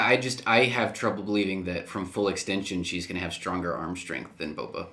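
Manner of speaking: usual speaking voice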